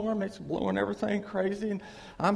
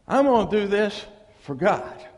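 mock boast; 8 dB louder than usual. Mocking churchgoer's boast.

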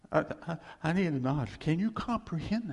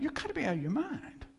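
incredulous thought; 10 semitones higher, 3 dB quieter, faster than usual. Retold or imitated. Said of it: "I'm thinking".

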